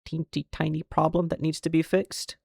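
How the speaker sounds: put-on sarcastic baby-talk tone; mock understatement. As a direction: play it down with a cutesy sneer. Sarcastic understatement of big problem.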